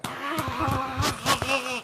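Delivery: playful ape noise; mock ape call. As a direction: do a quick ape yelp.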